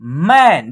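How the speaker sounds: "मैन" low and loud, with an open "ऐ" vowel; model pronunciation. Shown as a demonstration. Teacher models "मैन" for students, low and loud. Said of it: "इसको मन नहीं पढ़ना है".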